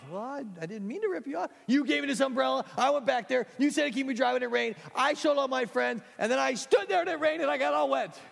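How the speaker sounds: high, loud whiny complaint; George, upset. Acted. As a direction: whine it like a wronged kid.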